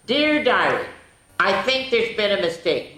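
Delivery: animated picture-book narration; reading to children. A narrator's reading. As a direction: read the diary like a bedtime story. Reads diary entries aloud.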